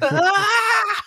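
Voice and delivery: high, loud mock shriek of fright; imitated baby's scream. Dad retelling his baby's fright at Ganon, imitated scream. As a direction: imitate a startled baby screaming. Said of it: "and then starts crying".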